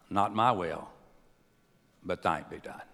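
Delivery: low, hushed surrender; quiet submission. Retold or imitated. Voicing Jesus' submission.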